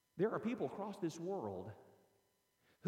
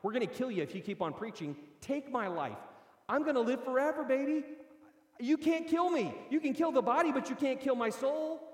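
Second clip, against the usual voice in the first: high, loud, defiant voicing of the martyrs; triumphant taunt to persecutors, raised and loud; 9 semitones higher, 5 dB louder than usual. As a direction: throw it back at the killers, fearless. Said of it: "they say".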